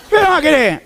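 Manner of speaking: high, brusque shopkeeper's question; lightly voiced grocer asking what else; gruff grocer. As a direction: toss it off like the busy grocer.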